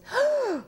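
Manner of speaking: sharp high gasp of recognition. Retold or imitated. Eye doctor spotting a celebrity patient, sudden gasp. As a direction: gasp like you just spotted a star.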